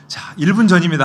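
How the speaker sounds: loud, high countdown sales call; home shopping host. Retold or imitated. Home shopping host urging viewers to call, loud. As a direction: rush the viewers to buy. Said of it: "홈쇼핑에서".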